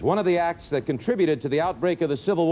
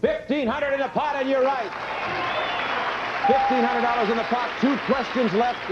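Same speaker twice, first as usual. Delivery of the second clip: booming prize announcer; 7 semitones higher than usual. Real, not acted. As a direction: announce the win with big energy. Host announces correct answer, prize.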